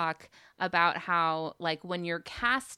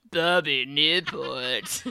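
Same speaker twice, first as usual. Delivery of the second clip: laughing slow; 7 semitones lower, 6 dB louder, much slower than usual. Shown as a demonstration. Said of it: "You gotta say it, like, really menacing".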